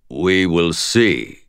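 low, very slow, resonant; otherworldly inner voice. A narrator's reading. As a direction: draw it out like a voice inside someone's head. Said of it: "My thoughts resonated abruptly with another voice".

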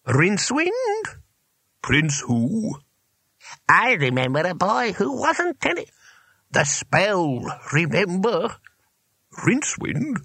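put-on high old wizard voices, puzzled; querulous old wizards. A narrator's reading. Old wizards behind the door recalling a pupil, querulous. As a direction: confer in a high, incredulous old voice.

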